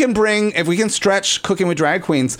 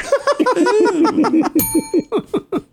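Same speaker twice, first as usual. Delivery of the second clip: long, high-pitched cackle; helpless high laughter; high giggling laugh. Real, not acted. Laughs at own innuendo.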